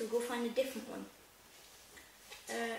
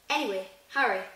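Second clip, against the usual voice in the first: louder call; 8 dB louder than usual. Acted. Giant turning to Harry.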